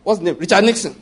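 ordinary talk

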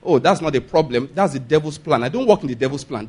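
calm, low, easy reply; a calm, unbothered answer; said calmly. Retold or imitated. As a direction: brush it off calmly and softly. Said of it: "the man just said to her calmly".

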